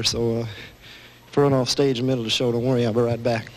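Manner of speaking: lightly voiced low Elvis mumble, out of breath; a hint of Elvis catching his breath on stage; [name]. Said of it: "[name]".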